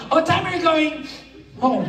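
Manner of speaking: light high English small talk; tossed off like a passing student; mock student chatter. Comic mimicking students at the bus stop.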